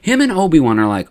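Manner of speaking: usual speaking voice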